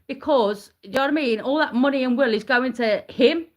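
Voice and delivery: normal talking voice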